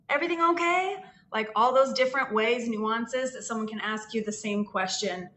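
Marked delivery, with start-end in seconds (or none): 0.1-1.0 shop clerk voice. Retold or imitated. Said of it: "different ways that we say hello".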